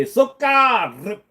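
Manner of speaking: word "Sukar" said with a heavy Russian accent, high pitch. Shown as a demonstration. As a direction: throw "Sukar" out in a thick Russian accent on request. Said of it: "in Russian accent".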